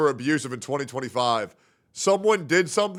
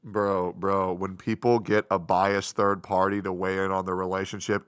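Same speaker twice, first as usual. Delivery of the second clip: ordinary talk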